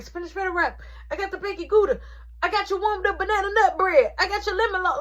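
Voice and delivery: high, loud rapid order call-out. Retold or imitated. Barista calling out food orders to customers, loud and high. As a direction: call the orders out over the rush.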